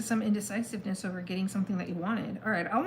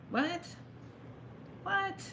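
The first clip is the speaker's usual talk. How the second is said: a real startled what, pitched high; 11 semitones higher, 5 dB quieter than usual. Real, not acted. Reader sees a card twice, sharply surprised.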